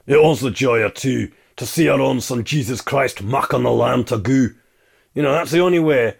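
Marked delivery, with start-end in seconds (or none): 0.1-4.5 mock broad Scots delivery of a carol line; exaggerated Scottish accent for a lyric. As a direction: put on a thick Scottish accent. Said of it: "a very broad Scottish accent singing it".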